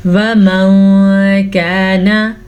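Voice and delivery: वमन drawn out with the n hidden in a nasal hum; slowly nasalise the n of वमन; slow tajweed recitation; ikhfa demo. Shown as a demonstration. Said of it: "नून साकिन के बाद काफ".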